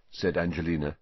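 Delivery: plain reading voice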